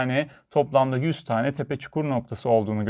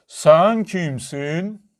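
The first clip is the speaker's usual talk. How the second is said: very slow, stretched echoing call; mountain echo; 3 semitones higher, 4 dB louder, much slower than usual. A narrator's reading. Mountain throws the girl's question back, drawn-out echo. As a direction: draw each word out long like an echo. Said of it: "Karşıki dağdan bir ses geldi".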